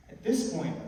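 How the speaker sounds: ordinary talk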